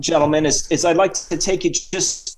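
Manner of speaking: chatty put-on Cockney-Australian accent; Sir Reginald. Guest introduces the hosts to sport in fake Cockney-Australian accent. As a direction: ramble as a mock Cockney-Aussie guest. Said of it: "bit of a Cockney accent and Australian".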